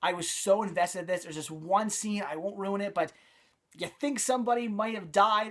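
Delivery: ordinary talk, nothing marked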